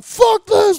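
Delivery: kicking down newspaper boxes in fury; furious shout. Retold or imitated. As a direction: rage while kicking things. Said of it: "I'm sidekicking 'em".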